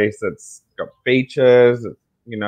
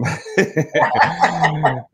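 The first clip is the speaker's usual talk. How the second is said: higher-pitched chuckle; amused laugh; 7 semitones higher than usual. Real, not acted. Guest joking with host about the future, amused laugh. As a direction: laugh at your own quip.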